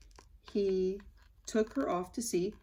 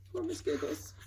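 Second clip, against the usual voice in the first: higher, soft pitying sigh; 8 semitones higher, 5 dB quieter than usual. A narrator's reading. Narrator pitying little Miss Giggles, softly. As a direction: sigh it with pity.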